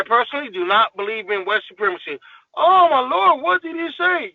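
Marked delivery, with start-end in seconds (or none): 2.6-4.3 high, loud clutch-the-pearls gasp; mock gasp of shock. Mock-shocked listener reacting to his opinion, high and loud.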